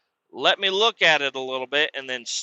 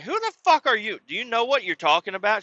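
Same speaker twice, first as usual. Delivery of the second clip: high, fast outraged comeback; indignant yell; 9 semitones higher, faster than usual. Retold or imitated. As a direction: snap back at the mom. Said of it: "I'm like".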